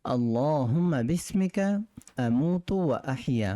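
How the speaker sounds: slow, deliberate Arabic recitation. Shown as a demonstration. Teacher repeats the sleep dua slowly, deliberate. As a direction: draw out each word for students to copy. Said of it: "আবার বলছি".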